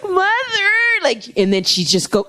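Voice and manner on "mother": imitating Regan, pitched up high; a weak girl calling for her mother; high pleading call. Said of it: "she's just like".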